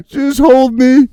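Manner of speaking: hammed-up crybaby begging his girlfriend to hold him; mock sobbing plea. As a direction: ham up a pathetic weepy plea. Mock crying in fetal position.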